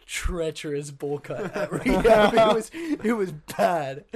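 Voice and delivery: can't hold back the laugh at the memory. Real, not acted. Laughing about his rehab haircut.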